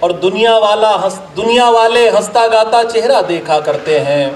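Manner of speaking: measured, declaimed Urdu couplets with pauses; ghazal recitation. Acted. Poet presenting a ghazal to an appreciative gathering, declaimed recitation. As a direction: recite verse to a mushaira audience, line by line.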